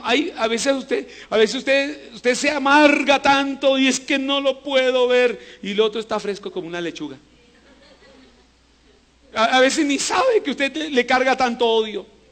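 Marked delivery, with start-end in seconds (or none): 0.0-5.6 preaching at full force. Acted. Preacher warns the church about bitterness, forceful and slow. 9.3-12.2 preaching at full force. Acted.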